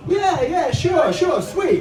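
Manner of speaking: high, hurried yeah yeah sure sure; guilty husband. Retold or imitated. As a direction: brush her off nervously and quickly.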